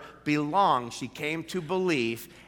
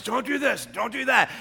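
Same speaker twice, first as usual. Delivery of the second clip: lightly mocking preachy don'ts; sing-song scolding church voice; mock nagging church; 9 semitones higher, 4 dB louder than usual. Sandy mimicking churches, mock scolding. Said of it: "Churches are always".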